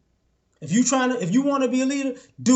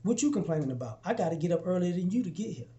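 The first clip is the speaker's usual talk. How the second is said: calm fraternity leader; 6 dB quieter than usual. Retold or imitated. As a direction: quietly put the complainer in his place. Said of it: "he says".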